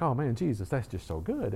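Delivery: soft, falling away at the end; retold murmur of worship to Jesus; hushed worship. Worshiper alone in his living room, hushed.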